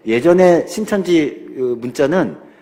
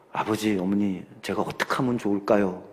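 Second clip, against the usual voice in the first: humble question; 7 semitones lower, 8 dB quieter than usual. Retold or imitated. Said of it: "라고 물어보더랍니다".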